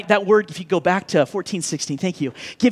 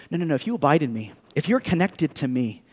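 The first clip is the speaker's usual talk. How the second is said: gentle Jesus; 6 semitones lower, 5 dB quieter than usual. Retold or imitated. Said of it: "'Cause Jesus is saying".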